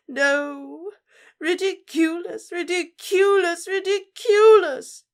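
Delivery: building from high sob to a loud, very high wail; hysterical sobbing. Acted. Mother screams the spell at rising horror, building to a wail.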